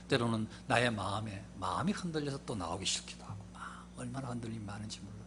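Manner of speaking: low-key aside to the congregation; hushed confession. Acted. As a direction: drop to a confiding hush. Confiding church struggles.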